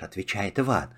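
ordinary delivery

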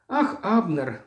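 plain reading voice

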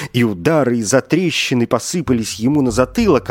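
usual speaking voice

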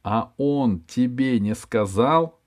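each word stretched out slowly; slow careful articulation. Acted. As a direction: shape every word deliberately, slowly. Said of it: "старательно выговаривая слова".

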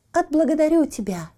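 high, thin squeaky little voice. A narrator's reading. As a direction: squeak it out like a tiny coal man. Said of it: "тонкий писклявый голосок".

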